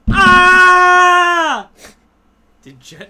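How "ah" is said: high, loud sudden yell; startled shout. Real, not acted. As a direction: yell out in shock.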